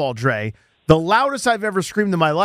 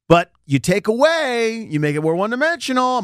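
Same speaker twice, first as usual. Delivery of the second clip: pitched up, stretched-out emphasis; voice going up; 6 semitones higher than usual. Real, not acted. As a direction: let the voice climb high as you make the point. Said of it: "My voice goes up a lot".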